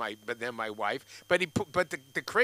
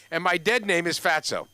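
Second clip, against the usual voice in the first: normal talking voice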